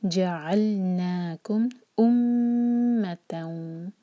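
very slow chant with nasal ghunna on मीम; Quran recitation. Shown as a demonstration. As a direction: recite it slowly, humming the मीम. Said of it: "मीम पर गुन्ना".

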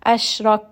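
Ashrak ending in a puff of breath on the kaf; breathy kaf. Shown as a demonstration. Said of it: "we're going to add in a little bit of air".

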